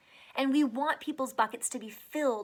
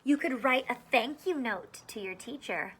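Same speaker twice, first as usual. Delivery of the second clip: lively picture-book read-aloud; reading to children; 2 semitones higher, 3 dB louder than usual. Acted. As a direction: read it out to a class of small kids. Reads picture book to kids.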